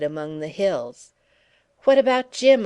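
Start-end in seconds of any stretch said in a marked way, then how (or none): 1.8-2.7 high, loud urgent question. A narrator's reading. Jock pressing Edie about Jim, high and loud. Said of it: "I asked".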